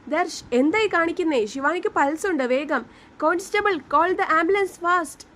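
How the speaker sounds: high, urgent call for help; urgent shout. A narrator's reading. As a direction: shout the order to hurry. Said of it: "inspector ഉറക്കെ അലറി". (ഉറക്കെയുള്ള അലർച്ച)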